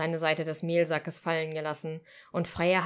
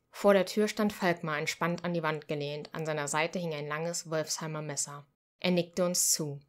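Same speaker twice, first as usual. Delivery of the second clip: usual speaking voice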